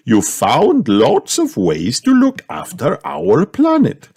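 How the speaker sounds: teacher praising her class; Madame Gazelle praising. A narrator's reading. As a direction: savour the praise like a gentle teacher. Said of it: "said Madame Gazelle".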